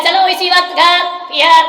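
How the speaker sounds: loud, high insistence; loud, stubborn daughter; bossy demand. Retold or imitated. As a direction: order father to take her home now. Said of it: "लड़की ने कहा".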